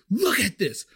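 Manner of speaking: high-pitched mock customer outrage; mock yelling. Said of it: "She's, like, yelling at me".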